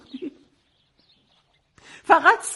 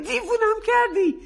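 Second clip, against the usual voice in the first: weary repeat; 6 semitones higher than usual. Acted. Mother repeating to her daughter, tired and exasperated. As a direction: drop the volume, still exasperated.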